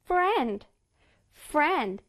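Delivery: "friend" with the F snapped like a rubber band; springy English F at the start of "friend". Shown as a demonstration. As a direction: flick the lip off the teeth for "friend". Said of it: "마치 고무줄 튕기듯".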